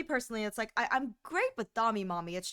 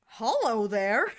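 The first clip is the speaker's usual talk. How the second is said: flirty hello; 6 dB louder, much slower than usual. Retold or imitated. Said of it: "Aglaya was the voice I go".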